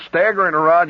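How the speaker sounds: normal talking voice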